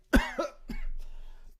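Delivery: breathy voiceless cough; choking rasp of a cough; real coughing fit. Real, not acted. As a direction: wheeze out a cough. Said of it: "coffee went down the wrong way".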